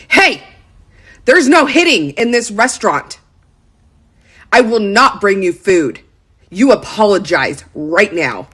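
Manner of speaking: stern, emphatic mock outburst; waiter losing it. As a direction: imitate a waiter telling a child off. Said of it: "loses it".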